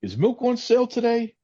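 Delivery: high, chirpy mock shopper voice; mock customer. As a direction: play a clueless customer pestering a cashier off duty. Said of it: "come up to her and say".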